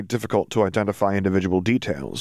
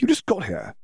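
mildly scoffing, pitched high; 11 semitones higher than usual. A narrator's reading. Draco dismisses newcomer Dimitri, scoffing. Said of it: "Draco scoffed".

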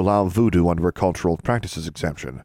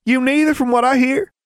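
very high, loud smug comeback; smirking retort; 5 dB louder than usual. A narrator's reading. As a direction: toss it back with a smirk, pitched way up. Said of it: "Mordecai replied with a smirk".

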